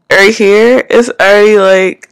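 speaking with lip held down; talking around finger; finger pulling lip down. Real, not acted. Seen 1: Fingertip holds lower lip down over braces throughout the labelled words.

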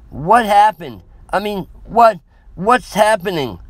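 high, loud, faltering panicked questions; shocked stammer. A narrator's reading. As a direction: struggle to get the words out. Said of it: "I could barely speak".

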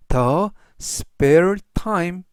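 ordinary talk, nothing marked

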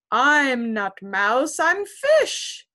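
slow, loud fish voice. Acted. Fish answers the kitten, slow and loud.

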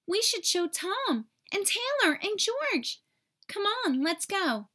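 put-on high, keen little boy urging friends on; lightly voiced excited boy; eager boy. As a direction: pull them along, can't wait.

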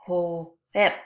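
"whip" split as a long hoo, then ip; segmented wh sound. Shown as a demonstration. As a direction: sound out "whip" in two parts. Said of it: "Listen as I say this word".

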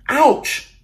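sudden high, loud yelp of pain; grandfather crying out. Acted. Abuelo crying out after tumbling onto a pumpkin, high yelp. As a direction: yelp like a grandpa who just landed hard. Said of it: "cried Abuelo".

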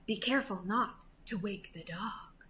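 hushed, secretive warning; cautious whisper. A narrator's reading. Thief warns partner near the dog, whispering. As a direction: whisper it so nobody wakes. Thieves creeping past sleeping dog.

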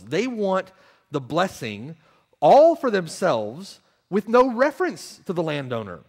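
forceful preaching. Acted. Preacher condemning those who want God's gifts, forceful preaching. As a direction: drive home every contrast like a preacher. Preacher denouncing willful rejection.

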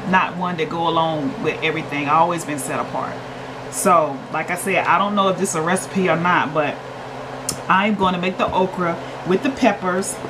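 ordinary delivery

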